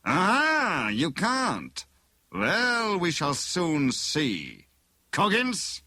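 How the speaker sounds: high villain voice, drawing out the threat; pounce, then summon the henchman; menacing villain. A narrator's reading. Bogus inspector cuts in on prisoner, menacing.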